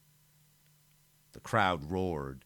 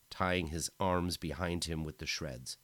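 usual speaking voice

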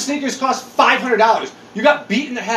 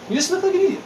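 higher, more scornful repeat; 9 semitones higher, much faster than usual. Shown as a demonstration. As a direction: scoff it higher the second time.

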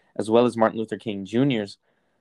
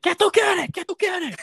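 much higher, brusque Spanish waiter voice asking what you want; Cuban waiter; slower than usual. Retold or imitated. A Cuban waiter asking dad what he wants, high brusque Spanish voice. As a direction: bark the order question like a busy Cuban waiter. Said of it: "he's like".

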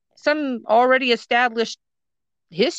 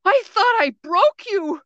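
high-pitched mock alarm; exaggerated high disbelief; retold shocked exclamation; 12 semitones higher, 3 dB louder than usual. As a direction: imitate the rattled manager way up high. Manager's shocked reaction, retold.

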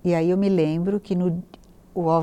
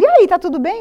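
high, loud, bright greeting; cheery asking friends; 10 semitones higher, 8 dB louder than usual. Retold or imitated. Friends asking the pregnant woman, high and bright. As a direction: voice everyone's breezy question. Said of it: "E todo mundo".

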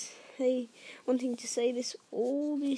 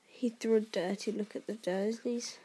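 plain reading voice

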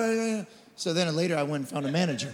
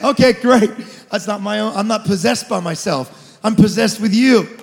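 loud, driving preacher's cry over music; full-force preaching; 3 semitones higher, 10 dB louder than usual. Acted. Pastor stirring up the congregation, shouting his sermon.

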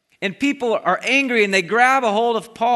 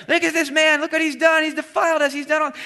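crowd yelling; 9 semitones higher than usual. Retold or imitated. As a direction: cry out against the prisoner. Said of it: "everybody around is yelling".

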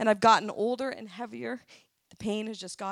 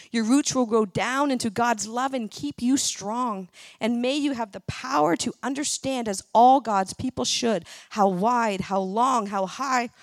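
louder, emphatic scripture reading; 5 dB louder than usual. Acted. Woman reads a Bible blessing to her congregation, proclaiming it. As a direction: proclaim the prayer with force.

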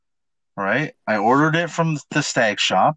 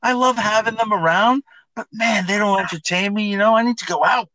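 high, bright stage-host voice pitched way up; pitched-up showman banter; talking like Brett Meester; 9 semitones higher than usual. Retold or imitated. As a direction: hype it like a guy working a crowd on stage. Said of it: "I'm talking like Brett Meester on stage".

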